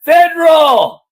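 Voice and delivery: loud, slow, drawn-out shout; indignant shout. Real, not acted. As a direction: bellow the word in protest. Insists on federal, not civil.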